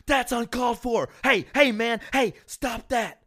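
high, outraged scolding, slowing at the end; hammed-up indignant fan; mock angry snap. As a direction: imitate an offended fan losing it. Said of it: "the guy snaps and loses his mind".

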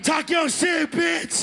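hype shout. Real, not acted. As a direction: cheer her on like a frat bro. Hyping up the contestant.

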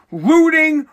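ordinary talk, nothing marked

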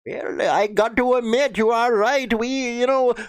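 lightly put-on Indian accent, answering back; mock Indian accent. Abib from India admits eating snakes, mild Indian accent. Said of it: "I know you do it in India".

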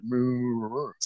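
soft wordless mumble like a far-off adult; quiet muffled moo-moo mumbling. Retold or imitated. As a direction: imitate Charlie Brown's parents on the phone. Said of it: "all they could hear was mumbling".

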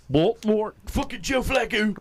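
high, loud Baltimore local; Baltimore accent. Retold or imitated. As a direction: play a rowdy Baltimore fan.

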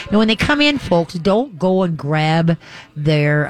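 ordinary delivery

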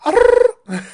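high, loud rolled-R trill; trilled "arr" catchphrase sound. Retold or imitated. Joking about a film title as a rapper would, high trill. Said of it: "as [name] would call it".

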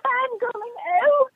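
high gushing fangirl voice. Retold or imitated. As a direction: gush like a starstruck fan. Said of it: "She's like".

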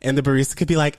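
ordinary talk, nothing marked

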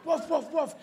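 high, emphatic woofs; very sure dog. Shown as a demonstration. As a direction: bark with total certainty. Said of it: "Very sure".

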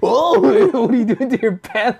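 high, laughing through the quote; laughing mock quote. Retelling the stranger's question to his girlfriend, laughing. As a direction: giggle out the stranger's words. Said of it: "What did he do?".

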